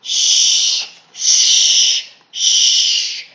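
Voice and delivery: loud hushing shh; loud shushing. Acted. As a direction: hush them hard. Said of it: "hushed the mouse".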